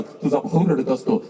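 ordinary talk, nothing marked

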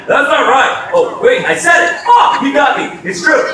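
excited yelp of a player tricked into saying it; caught out. Retold or imitated. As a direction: blurt it, then crack up at being caught. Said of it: "he's gonna be like".

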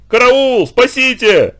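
high, loud panicked scream; desperate cry for help. Acted. Lyoshka alone at the hut screaming for rescue, panicked. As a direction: shriek for help as if something is attacking you. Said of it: "отчаянный крик Лёшки".

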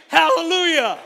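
loud, high hallelujah shout; full-throated hallelujah; joyful shout. Pretend. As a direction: raise the roof with it. Lively church contrasted with blah.

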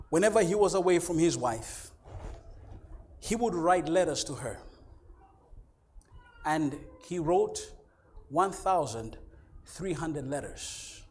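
ordinary talk, nothing marked

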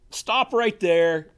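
loud, slow, emphatic command; firm stop. Real, not acted. Preacher halting the congregation, loud and slow. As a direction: slam the brakes on it, slow and loud. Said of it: "preacher warning congregation".

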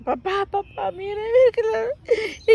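put-on high, loud childish wailing plea; crying to dad. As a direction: cry out to papa like a hurt little girl. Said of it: "y que yo me dejo una lloradera". (llanto de niña, agudo y fuerte)

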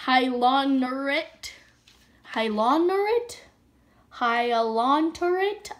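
slow, drawn-out trial sounding of Hyaluronate; unsure pronunciation. Real, not acted. Vlogger trying to read an ingredient aloud, slow and unsure. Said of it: "I don't know how to say that".

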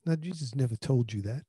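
usual speaking voice

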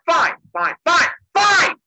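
loud, rising to a high shout; fed up. Retold or imitated. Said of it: "gets really fed up".

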